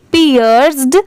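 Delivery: ordinary talk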